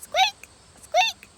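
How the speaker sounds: very high, loud squeaky peeps; duck squeaks. Retold or imitated. Showing viewers how muscovies squeak, very high. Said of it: "They talk in boops or squeak".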